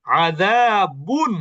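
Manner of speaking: আযাবুন slowly, long zaa, clean final n; clear nun. Shown as a demonstration. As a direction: stretch আযাবুন and land on a distinct বুন. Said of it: "এখানে কিন্তু বুনই লিখতে হবে". (আযাবুন টেনে, শেষে স্পষ্ট নুন)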